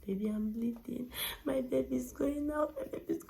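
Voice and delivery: imitating her tearful call to her husband; retold crying. Calling her husband while bleeding, retold in tears. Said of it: "I was crying".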